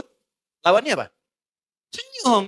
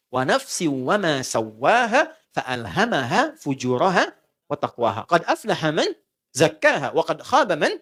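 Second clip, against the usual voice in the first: slow, drawn-out chanted recitation; slow Arabic Quran chant; 4 semitones lower, slower than usual. Acted. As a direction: recite the verse slowly, melodically. Quran verses recited in sermon.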